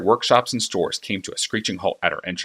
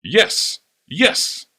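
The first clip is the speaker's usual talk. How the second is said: usual speaking voice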